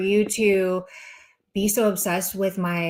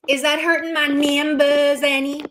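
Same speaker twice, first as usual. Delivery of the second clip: 1 sarcastic higher question; 6 semitones higher, 4 dB louder than usual. Real, not acted. Mocking rhetorical question.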